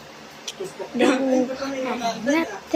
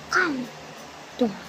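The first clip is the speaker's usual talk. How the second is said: usual speaking voice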